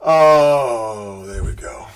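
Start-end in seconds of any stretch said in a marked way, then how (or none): 1.3-1.9 whisper. Real, not acted. Whispered aside.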